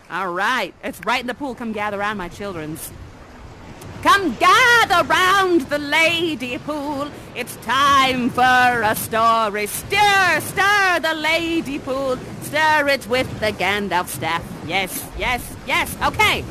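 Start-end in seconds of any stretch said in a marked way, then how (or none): 4.0-12.9 slow, drawn-out story invitation; storyteller call. Acted. To the children at the lady pool, a slow storyteller call. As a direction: summon the children to the tale.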